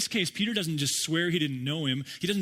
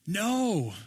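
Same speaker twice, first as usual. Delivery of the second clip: mock yell back; 8 semitones higher than usual. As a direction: yell back from the boat, joking. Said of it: "And they yell".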